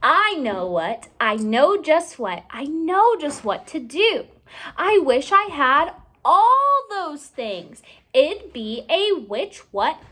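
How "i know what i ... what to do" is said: ordinary delivery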